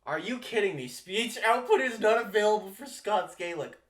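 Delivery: loud, high-pitched real exasperation; fed up and cursing at the computer; exasperated outburst. Real, not acted. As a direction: read out the error in loud, incredulous outrage. Outraged at failed Google Translate attempt.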